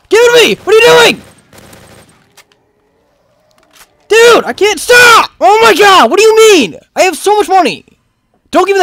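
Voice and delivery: high, loud panicked yelling; frantic, exasperated shouting; frantic frustration. Real, not acted. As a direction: yell at the game in outraged disbelief. Game refuses the purchase.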